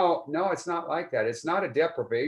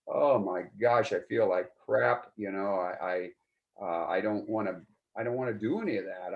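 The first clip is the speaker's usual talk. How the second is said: acted low mood; 5 semitones lower than usual. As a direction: imitate a weary, miserable morning self. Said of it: "to have your mood back".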